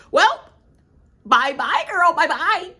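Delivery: high, loud sing-song bye-bye; gleeful goodbye. Real, not acted. Cheering a rival's exit, high and loud. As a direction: wave her off with glee.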